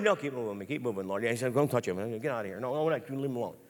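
low, hushed crowd-control orders; a hint of security men moving people along; lightly voiced bodyguards. Security men shielding Jesus as he walks, lightly voiced. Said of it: "his security men around him".

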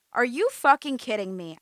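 loud, incredulous outburst; can't believe what she hears; exasperated. A narrator's reading. Amanda snaps at her cousin in the kitchen, incredulous.

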